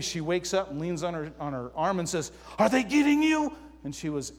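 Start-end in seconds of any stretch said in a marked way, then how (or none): 2.6-3.5 high, loud mock snap at night; half-hearted angry jab, pitched way up; imitating angry wife. Wife woken by mosquitoes, mock angry high outburst. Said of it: "And she got so angry".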